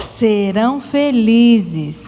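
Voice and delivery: put-on slow, clear line for children to echo; slow verse for repeating. Shown as a demonstration. Teacher leading children in a verse, slow and clear. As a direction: spell it out slowly so the kids can repeat. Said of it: "Vamos repetir comigo?".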